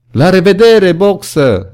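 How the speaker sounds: high, loud farewell call; calling in chorus. A narrator's reading. Animals calling goodbye to Boxer, loud cheerful call. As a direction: call goodbye across the yard. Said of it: "ziseră ele în cor".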